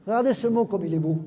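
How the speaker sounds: usual speaking voice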